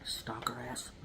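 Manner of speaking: whispered insult under breath; whispered aside. Real, not acted. Muttering about the stalker neighbor, whispered. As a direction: whisper it to the camera.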